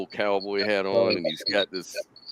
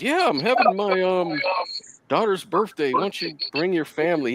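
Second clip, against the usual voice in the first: lightly voiced boss, pitched higher; a casual invite in a higher put-on voice; imitating the boss; 7 semitones higher than usual. Boss invites him to party.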